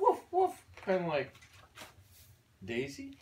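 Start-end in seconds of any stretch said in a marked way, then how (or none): 0.0-0.7 high, playful little woofs; dog bark. Pretend.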